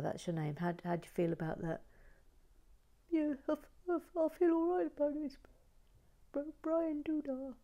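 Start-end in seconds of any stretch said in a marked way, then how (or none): 3.1-7.5 high, timid little dog voice answering the owner; pet's voice. Pretend. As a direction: speak for the puppy, small and shy. Voicing the pet's reply.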